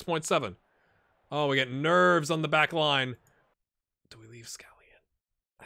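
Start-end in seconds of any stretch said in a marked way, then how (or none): 4.1-5.6 unvoiced, slow whisper. Real, not acted. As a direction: ask it under your breath. Whispered aside during tense play.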